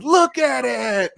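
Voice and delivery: put-on high, slow old man voice. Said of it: "in my old man voice".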